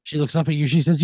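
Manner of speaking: ordinary talk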